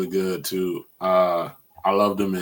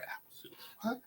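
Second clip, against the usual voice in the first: whispered apple juice; 13 semitones higher, 22 dB quieter, faster than usual. Retold or imitated. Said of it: "And he's like".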